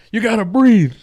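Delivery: high, loud yelled coaching; retelling game show host yelling at frozen contestant, mock shout. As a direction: urgently yell at him to breathe. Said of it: "and they're like".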